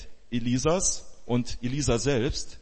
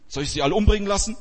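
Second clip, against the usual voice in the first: agitated king; 7 semitones higher, 4 dB louder, much faster than usual. Acted. King asks Elisha, agitated.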